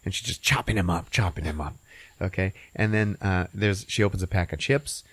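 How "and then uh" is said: ordinary talk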